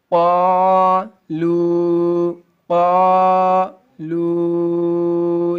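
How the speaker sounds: قالو chanted very slowly, "qaa-loo"; short madd. Shown as a demonstration. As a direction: hold both vowels of قالو long and level. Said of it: "چھوٹی مد میں ڈھائی تین الف کے برابر کھینچیں گے".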